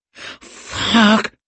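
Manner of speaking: high-pitched pained whimper. A narrator's reading. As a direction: let it squeeze out high, like a whimper. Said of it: "he whimpered".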